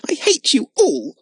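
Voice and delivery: very high, loud outburst of rage; tearful rage. Acted. Lotta yells at Jimmy before running off, high furious cry. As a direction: fling it out through angry tears. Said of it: "with tears of rage".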